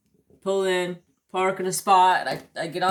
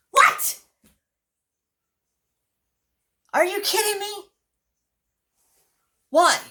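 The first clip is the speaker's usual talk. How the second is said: much higher, louder incredulous yelps; retold shocked outburst; 6 dB louder than usual. Customer told his order was canceled, incredulous. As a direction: squawk each question in high-pitched disbelief.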